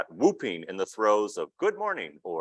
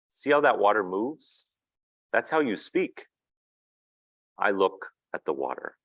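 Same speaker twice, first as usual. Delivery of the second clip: measured storybook narration, a touch slower; picture book reading. A narrator's reading. Reading a picture book aloud to an audience, storybook narration. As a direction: read the child's words gently to the room.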